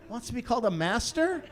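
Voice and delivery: ordinary talk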